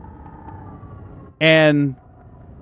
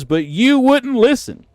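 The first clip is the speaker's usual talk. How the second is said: mock clerk taunt, higher; lightly mocking clerk, raised pitch; quoting the clerk, pitched up; 8 semitones higher than usual. Streamer quoting clerk to the kid, higher mock taunt. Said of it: "And he says".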